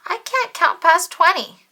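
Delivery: a big dramatic complaint; blurting out the problem, high and loud; upset character wail. Acted. Character wails in the story.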